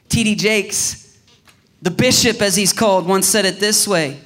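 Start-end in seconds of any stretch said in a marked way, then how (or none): none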